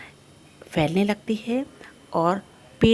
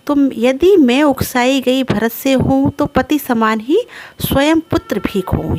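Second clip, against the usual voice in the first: higher, emphatic poetry reading; verse recitation; 5 semitones higher than usual. Acted. Kaikeyi swearing on her son, raised recitation. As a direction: recite it as Kaikeyi's solemn declaration.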